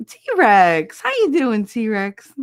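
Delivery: loud, high sing-out hello; excited greeting. Real, not acted. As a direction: call out happily to a favorite regular. Calls out greeting to favorite viewer.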